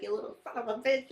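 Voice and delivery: high, quick mock yell; retold yelling. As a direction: imitate an angry man yelling at a kid. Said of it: "he's yelling at the kid".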